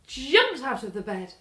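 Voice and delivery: ordinary delivery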